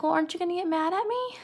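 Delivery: high voice, rising at the end; Lenny asking. Retold or imitated. Lenny asking George about getting mad, high and questioning. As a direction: imitate a simple man's tentative question. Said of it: "And Lenny's like".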